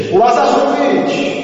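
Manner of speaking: high, slow commanding call; crying out. Shown as a demonstration. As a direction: call Lazarus out of the tomb. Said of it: "będziemy krzyczeć". (wołając wysoko i powoli)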